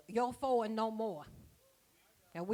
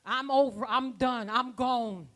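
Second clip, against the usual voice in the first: quoting mother; 7 dB louder than usual. Retold or imitated. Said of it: "she wouldn't have said".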